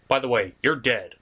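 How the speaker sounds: ordinary talk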